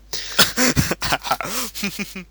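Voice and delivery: loud high burst of laughter; howling with laughter; big laugh. Real, not acted. Friend laughing hard.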